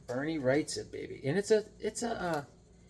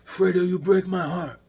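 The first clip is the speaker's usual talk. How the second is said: Godfather quote; 5 semitones higher, 4 dB louder than usual. Retold or imitated. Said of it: "Not Fredo from Godfather".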